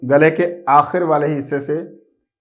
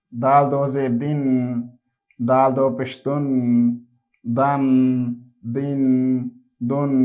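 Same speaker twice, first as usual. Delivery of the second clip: put-on steady sing-song letter drill, said slowly for children; measured recitation for a child to repeat after; 2 semitones lower, 5 dB quieter, slower than usual. Shown as a demonstration. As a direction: chant each syllable like a classroom takhti reading. Teacher chants the alphabet drill.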